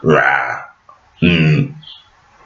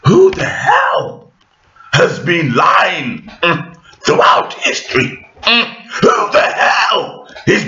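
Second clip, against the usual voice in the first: high, slow, shouted accusation; outraged shout; 9 semitones higher, slower than usual. Real, not acted. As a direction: hurl the question out in outrage. Ranting about who lies in history.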